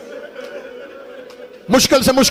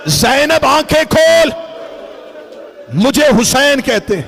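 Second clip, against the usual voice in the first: restrained plea; 3 semitones higher than usual. Acted. Hussain introducing himself to baby sister, restrained plea. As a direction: lament easing down at the end. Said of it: "رو کے حسین کی آواز آئی".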